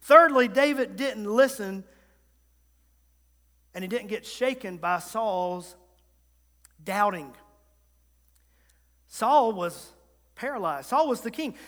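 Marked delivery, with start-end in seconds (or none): none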